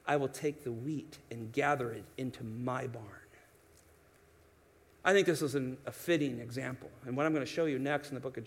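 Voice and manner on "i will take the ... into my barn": low, soft, settled master's voice; the landowner tells his servants to wait, low and soft; the master speaking. Retold or imitated. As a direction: hush it down like a calm landowner. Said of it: "And then he says this".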